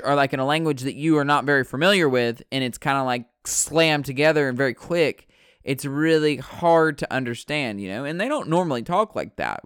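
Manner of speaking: usual speaking voice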